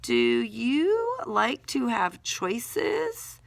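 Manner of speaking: very slow, word-by-word question; slow dictation. Shown as a demonstration. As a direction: draw the question out while writing. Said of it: "saying what you're writing".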